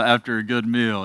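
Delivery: ordinary talk, nothing marked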